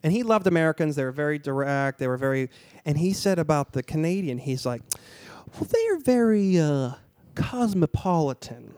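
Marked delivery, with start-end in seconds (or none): 5.5-8.7 insinuating. Retold or imitated. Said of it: "he said it in a way that made me".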